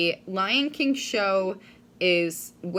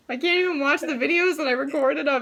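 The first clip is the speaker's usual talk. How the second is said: laughing on the verge of tears; 6 semitones higher, 3 dB louder than usual. Real, not acted. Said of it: "because I just cry".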